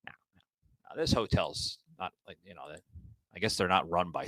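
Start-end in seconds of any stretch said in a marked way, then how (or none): 0.1-0.1 mock snooty hotel staff. Mocking snooty hotel staff who refuse his sound panels. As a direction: sniff at the sound panels like a snob. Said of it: "they give you that, like".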